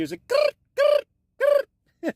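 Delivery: high, loud growling buzz of a radio; walkie-talkie static noise. Shown as a demonstration. Recalling old walkie-talkie phones, a high buzzing grrr. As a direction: make the crackle of a push-to-talk phone. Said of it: "imitating walkie-talkie static".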